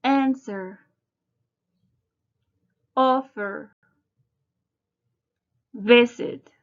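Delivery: "Answer", "Offer", "Visit" stressed on the first syllable, AN-swer, OF-fer, VI-sit. Shown as a demonstration. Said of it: "Notice the pronunciation of these verbs".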